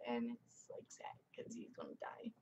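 choked, very soft whisper near tears; real sadness, barely holding back tears; tearful whisper. Real, not acted. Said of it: "I get so teary-eyed thinking about".

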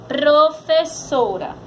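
"Professora" slowly, joined into one word; palavra juntada. Shown as a demonstration. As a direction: put "Professora" back together, carefully. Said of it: "Vamos pra palavra". ("Professora" devagar, palavra inteira)

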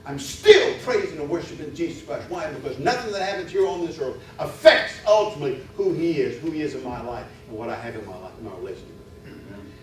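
loud, high, driving preacher voice; full-force preaching. Acted. Preacher proclaiming his faith to the congregation, full-force preaching. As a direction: hammer each line at the congregation.

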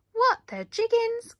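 high, loud astonished cry; the little bees gasp in disbelief; shocked gasp. A narrator's reading. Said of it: "gasped all the little bees".

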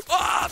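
short, high-pitched yelp after a gasp; gasp, then yelp high; startled scream. Real, not acted. Gamer caught off guard in a fight, startled yelp. Startled by sudden attack in game.

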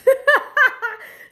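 high, loud burst of nervous laughter; real giddy laugh. Real, not acted. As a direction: laugh out the nerves in a high squeal. Nervous laugh about eating deadly bug.

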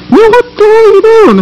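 high exasperated shout-question; annoyed outburst. Acted. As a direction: demand what he's doing. Scolding partner again.

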